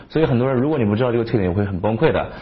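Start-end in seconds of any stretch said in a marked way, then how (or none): none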